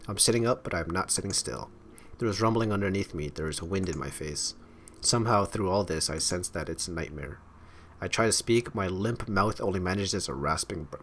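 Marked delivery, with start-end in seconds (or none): none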